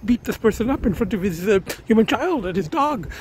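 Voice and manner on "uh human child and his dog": normal talking voice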